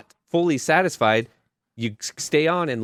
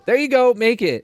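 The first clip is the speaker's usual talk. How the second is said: mock producer; 11 semitones higher, 4 dB louder than usual. Producers handing over money, acted.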